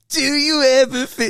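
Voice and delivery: high, drawn-out impression through laughter; friend's bad impression. Comic mimics his friend showing off an impression, hammed-up impression. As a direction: ham up a friend's shocking impression. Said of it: "he practices his impressions in the mirror".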